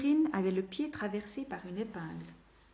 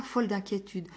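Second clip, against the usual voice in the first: usual speaking voice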